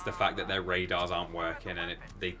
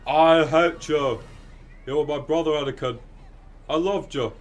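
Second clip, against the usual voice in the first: Obi-Wan as Brian Butterfield; 6 semitones higher, 9 dB louder than usual. Retold or imitated. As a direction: overplay the betrayal like a hapless Brit. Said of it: "Obi-Wan impression ... as Brian Butterfield".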